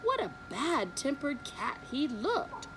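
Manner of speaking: plain reading voice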